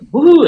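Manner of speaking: loud, high celebratory "woo"; retold whoop of triumph; ecstatic whoop. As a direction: let out a high, thrilled whoop. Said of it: "ecstatic, and I, I'm like".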